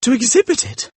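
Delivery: high-pitched, horrified questioning; terrified exclamation. A narrator's reading. Dorian learning the portrait will be exhibited, in terror. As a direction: echo it in alarm, voice leaping up. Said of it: "exclaimed Dorian Gray in terror".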